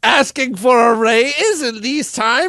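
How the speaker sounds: hammed-up scandalized old boss; mock outraged boss. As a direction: sputter with fake outrage.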